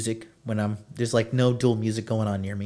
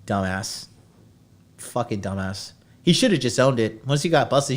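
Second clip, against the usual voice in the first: ordinary talk, nothing marked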